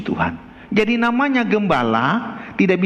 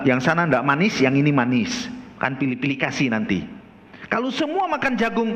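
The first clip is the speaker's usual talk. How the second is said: normal talking voice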